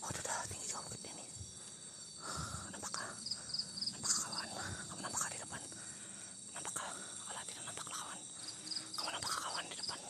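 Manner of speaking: hushed unvoiced whisper throughout. Real, not acted. Vlogger whispering to viewers while peering ahead, whisper. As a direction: keep it to a breath so nothing hears.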